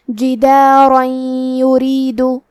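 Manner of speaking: جدارن یریدو with the noon merged into یریدو, nasal hum and doubled ya, drawn out slowly; slow tajweed demo of جدارن یریدو with idgham and ghunnah; ghunnah merging demo. Shown as a demonstration. Tajweed teacher showing the student جدارن یریدو slowly with ghunnah. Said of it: "کلمت جدارن کے آخری میں را پہ تنوین زبر ہے".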